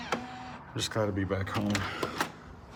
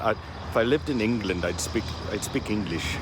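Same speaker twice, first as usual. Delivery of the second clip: bad English accent. Vlogger musing on living in England, faking a bad English accent. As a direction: put on a mock English accent and miss it a little. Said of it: "that wasn't a very good English".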